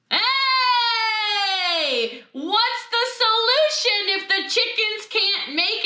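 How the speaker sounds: high, drawn-out mock revelation; excited realization. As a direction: sing out a sudden bright idea. Acted reaction to husband's bright idea.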